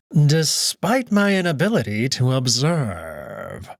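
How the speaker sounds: slow, silky mocking host voice; sly Cato. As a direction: purr the welcome with false courtesy, drawn out. Cato boasts of trip.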